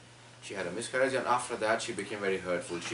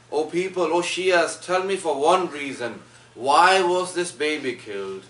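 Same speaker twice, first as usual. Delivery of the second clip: anguished call; 3 semitones higher, 7 dB louder, slower than usual. A narrator's reading. Imam confronting crowd over baby.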